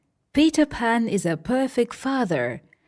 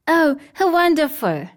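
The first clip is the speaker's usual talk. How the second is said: higher, louder joyful cry; delighted; 7 semitones higher, 5 dB louder than usual. Acted. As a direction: burst with happiness. Lost boys delighted.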